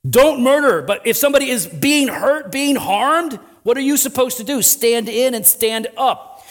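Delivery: emphatic pulpit exhortation; forceful preaching. Real, not acted. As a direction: drive it home to the congregation.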